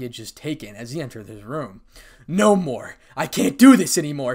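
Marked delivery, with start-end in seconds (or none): 2.3-4.3 loud, high outburst; anguished shout. A narrator's reading. Issei starting to cry in his room, shouting. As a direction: yell it at breaking point. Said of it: "shouted Issei internally as he started to cry".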